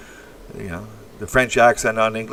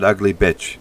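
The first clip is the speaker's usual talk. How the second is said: ordinary delivery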